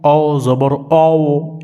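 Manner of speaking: "অও" held long as a rounded "ow"; common wrong reading. Shown as a demonstration. Teacher repeats the mistake for students, "অও" as "ow". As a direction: slowly chant the mistaken "অও", with no "aa" ending. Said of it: "এটা কিন্তু ভুল".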